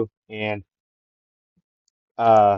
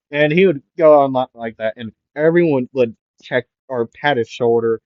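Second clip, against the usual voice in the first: ordinary talk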